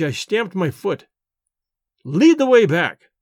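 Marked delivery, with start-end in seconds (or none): 2.0-3.1 loud, slow roar. A narrator's reading. Narrator roaring at his servant to lead back. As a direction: bark the order like a furious master. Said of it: "I roared".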